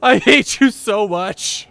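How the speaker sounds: loud furious shouting; angry yelling. Real, not acted. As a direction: scream insults at the monster. Yelling at the Leviathan.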